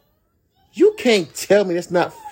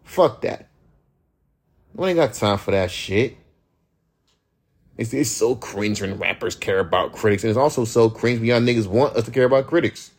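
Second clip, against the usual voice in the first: ordinary delivery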